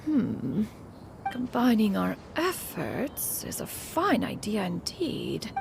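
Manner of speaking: normal talking voice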